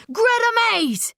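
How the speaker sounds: high, urgent bark of a name; high-pitched barking shout; barked call. A narrator's reading. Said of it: "he barks as he enters".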